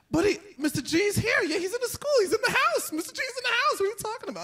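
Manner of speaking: very high mock-cheery bluster; high-pitched flustered reply. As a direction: babble defensively in a comic high voice. Flustered teacher answering the kid.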